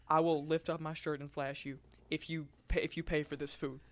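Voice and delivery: soft, low, hushed offer to the cashier; hushed come-on. Retold or imitated. Customer quietly propositions the cashier, hushed and low. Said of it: "she says".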